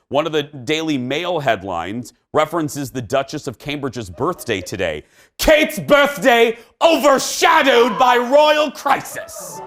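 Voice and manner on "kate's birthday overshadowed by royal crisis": mock British tabloid voice, higher, louder and slow; British accent. Host reading tabloid headline in mock British accent. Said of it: "That was a nice accent".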